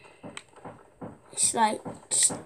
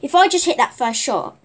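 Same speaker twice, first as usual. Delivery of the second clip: frustrated yell; 3 semitones higher, 10 dB louder than usual. Real, not acted. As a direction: yell at your own bad aim. Missed shot in game fight.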